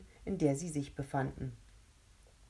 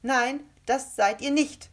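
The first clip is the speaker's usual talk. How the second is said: put-on slow, much higher and louder girl's voice; slight defiant voice; 9 semitones higher, 8 dB louder than usual. A narrator's reading. Rebecca contradicting the ghost leader: slow defiant call. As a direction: defy them from afar. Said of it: "sagte eine schmächtige Stimme".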